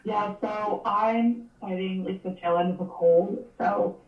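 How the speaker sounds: ordinary delivery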